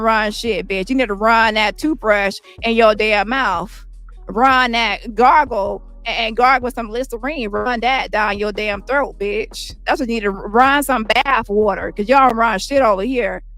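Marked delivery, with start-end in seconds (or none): none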